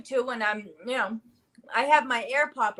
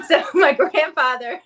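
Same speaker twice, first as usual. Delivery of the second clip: laughing; 7 semitones higher, 8 dB louder than usual. Real, not acted. Laughing at Mike in his underwear.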